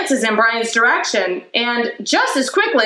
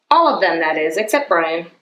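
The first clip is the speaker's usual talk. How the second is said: ordinary delivery, nothing marked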